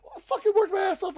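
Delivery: high, loud indignant whine; indignant protest. Retold or imitated. Wrestler protesting to his coach, indignant and loud. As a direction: protest like you've been robbed. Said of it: "And I'm like".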